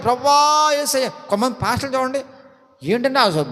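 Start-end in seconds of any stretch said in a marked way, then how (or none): 0.0-1.1 mock pastor's high, drawn-out prayer call; mimicry preacher voice. Mocking pastors who call to a faraway Lord in a put-on voice. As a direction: imitate a put-on praying voice, stretched and raised. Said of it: "mimicry ప-, స్వరం వచ్చేద్దాను అప్పటికప్పుడే".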